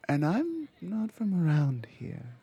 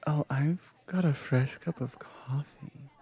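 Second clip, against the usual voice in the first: ordinary talk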